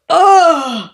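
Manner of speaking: disgusted groan. Real, not acted. Disgust at fourth book.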